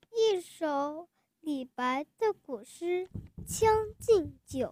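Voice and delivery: normal talking voice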